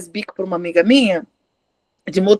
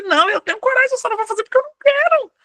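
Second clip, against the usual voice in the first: high-pitched, insistent self-defence; defiant retort; 11 semitones higher, faster than usual. Acted. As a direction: fire back at an accuser, voice pitched way up. Acted retort to accusation of cowardice. (réplica desafiadora, em tom agudo)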